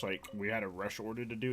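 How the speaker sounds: ordinary talk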